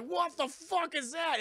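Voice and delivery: high, slow, stretched exclamation; hammed-up shock at a strange sight; mock alarmed disbelief. Onlookers spotting the giant blob, mock alarmed disbelief.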